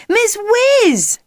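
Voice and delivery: very high, loud hail; shout. A narrator's reading. Jack running towards Miss Whiz at the crossing, shouting. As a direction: yell her name across the street. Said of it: "Jack shouted".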